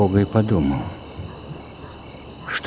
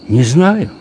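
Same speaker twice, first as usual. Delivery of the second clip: put-on small boy's voice, higher and louder; lightly acted high child voice; little boy; 7 semitones higher, 6 dB louder than usual. A narrator's reading. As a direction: pitch it up like a young child. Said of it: "сказал малыш".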